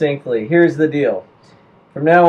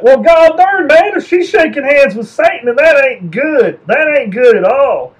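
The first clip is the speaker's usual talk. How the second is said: gullible yokel; 9 semitones higher, 5 dB louder than usual. Acted. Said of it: "and people are like".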